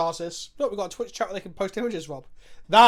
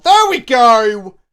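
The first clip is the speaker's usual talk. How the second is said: much louder, slow triumphant call; 3 semitones higher, 16 dB louder, much slower than usual. Real, not acted. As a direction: cheer the win. Game move succeeds.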